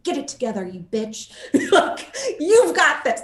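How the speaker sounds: a mild laughing pep talk to herself; self pep talk. Retold or imitated. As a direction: hype yourself up, half joking. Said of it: "giving myself a pump up speech".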